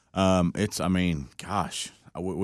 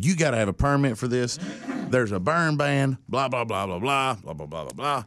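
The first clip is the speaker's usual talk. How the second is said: mock scolding firefighter; 7 semitones higher than usual. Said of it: "Fire department just reams".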